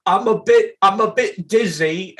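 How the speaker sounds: mock dizzy British lady after a flashbang; imitating a woozy game voice line. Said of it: "the one British lady who goes".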